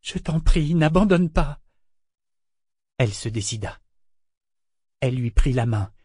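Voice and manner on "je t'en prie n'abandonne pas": high, loud desperate plea; pleading. A narrator's reading. Woman begging her lover not to give up, high and loud. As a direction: beg him not to give up. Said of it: "supplia-t-elle".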